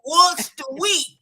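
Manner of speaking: laughing retelling. Retold or imitated. Daughter retelling her father's answer, laughing loud. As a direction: crack up while quoting dad. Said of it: "He said".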